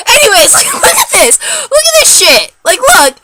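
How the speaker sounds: very high, loud squawk of outrage; exasperated outburst. Real, not acted. Showing viewers the ruined eyebrows, loudly exasperated. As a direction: demand that they see the damage.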